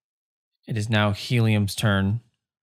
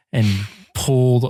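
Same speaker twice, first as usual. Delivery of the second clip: usual speaking voice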